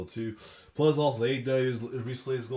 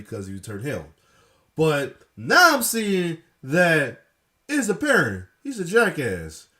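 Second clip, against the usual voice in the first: loud, high, worked-up rant; excited shout; 5 semitones higher, 9 dB louder than usual. Real, not acted. Host yelling at the screen about a wrestler's antics. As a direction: yell it out like a fired-up fan.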